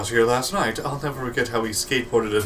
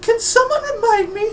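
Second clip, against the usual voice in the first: very high, thin character voice; high squeaky character voice; 6 dB louder than usual. Acted. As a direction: pitch way up for the character. Character voice in game scene.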